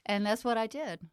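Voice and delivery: usual speaking voice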